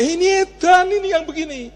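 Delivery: high, scoffing mock official; lightly voiced scornful official; mock disbelief. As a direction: scoff like the official calling them crazy. Official mocks the plan.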